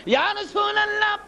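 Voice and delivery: a commander calling up to his leader; army commander's appeal. Acted. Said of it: "সৈন্যর সেনাপতি দাঁড়ায়া বলেন". (সেনাপতির উঁচু গলায় আবেদন)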